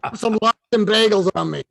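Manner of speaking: high, grumbling Brooklyn accent impression; comic Brooklyn kvetch, dismissing him; Larry King. Dead mentor sending Mark away, exasperated Brooklyn accent. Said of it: "Larry would say".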